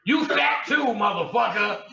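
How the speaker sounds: high, loud insult; mock crazy yell; angry yell. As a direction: scream it like the furious sister. Sister went crazy.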